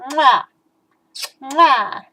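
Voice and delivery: loud smacking kiss sounds; blown kisses. Real, not acted. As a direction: blow kisses at the camera. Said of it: "Both kisses at the camera".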